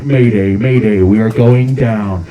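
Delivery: low, loud pilot's distress call; Kobe Bryant impression. Helicopter pilot calling mayday as it goes down, mock radio call. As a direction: drone it out like a cockpit radio mayday. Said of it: "Here's my Kobe Bryant impression".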